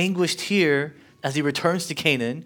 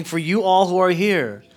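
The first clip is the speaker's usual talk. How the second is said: loud preaching; 5 semitones higher, 5 dB louder than usual. Retold or imitated. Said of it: "Jesus saying".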